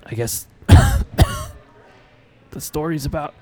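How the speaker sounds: slow, coughing, dying man; weak dying voice. Acted. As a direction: force it out between coughs. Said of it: "he says".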